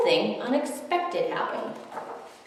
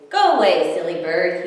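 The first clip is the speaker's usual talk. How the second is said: plain reading voice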